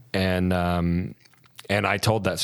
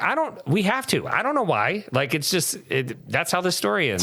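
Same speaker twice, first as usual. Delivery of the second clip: put-on drunk insistence; 10 semitones higher than usual. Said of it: "And I was like".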